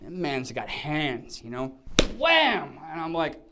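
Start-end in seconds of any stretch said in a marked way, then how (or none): 2.2-2.8 loud, high, sudden exclamation; imitated slap impact. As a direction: punch it out like a slap landing. Acting out the second slap.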